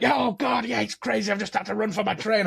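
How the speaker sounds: a light impression of a flustered mate; mock frantic friend. Mimicking a friend rushing for his train, mock frantic.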